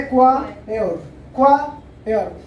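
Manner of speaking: क्वायर slowly, leaning on the first part "kwaa"; stress on KWA. Shown as a demonstration. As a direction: split क्वायर into KWAA-yer, falling away. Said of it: "हल्का सा stress देंगे क्वा पे".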